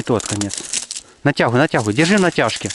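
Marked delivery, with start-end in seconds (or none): none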